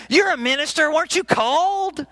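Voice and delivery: lightly acted neighbor in disbelief; incredulous challenge. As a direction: press them, pitch lifting.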